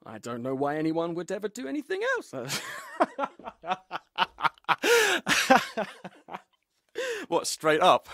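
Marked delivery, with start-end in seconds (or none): 2.4-6.1 a long, amused laugh that rises high; real laugh. Real, not acted. Actor laughing at their own joke, a high, amused laugh. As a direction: let a real laugh roll out. Laughing during recording session banter.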